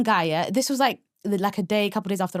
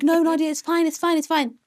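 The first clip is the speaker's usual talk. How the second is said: higher, breezy friends brushing off her fear; mock reassurance; 6 semitones higher than usual. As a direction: imitate friends waving her worry away. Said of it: "They're like".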